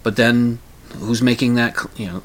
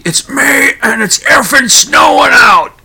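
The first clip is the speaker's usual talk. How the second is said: a sudden high, loud, slow yell; loud shout; 9 dB louder, slower than usual. Retold or imitated. Stranger at a bar yelling about May snow, loud shout. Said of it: "he just says very loudly".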